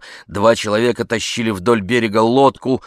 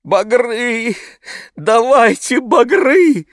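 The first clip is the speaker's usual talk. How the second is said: high, long-drawn anguished plea; groaning cry; 13 semitones higher, 5 dB louder, much slower than usual. A narrator's reading. Old man begs for boathooks, groaning. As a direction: wail it out slowly, despairing. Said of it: "простонал старик ему в ответ".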